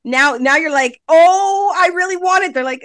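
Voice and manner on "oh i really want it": mock pleading. Labouring mother begging for the epidural, mock pleading. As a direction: beg in a raised, exaggerated voice.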